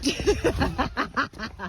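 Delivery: high, soft burst of giggles; real laugh. Real, not acted. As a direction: laugh it off after your own answer. Laughs after own answer.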